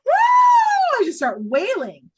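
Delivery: piercing high wail, very loud; reenacted wail. Reliving the moment, she wails out loud. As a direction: let out a sudden howling wail. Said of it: "I start wailing at that point".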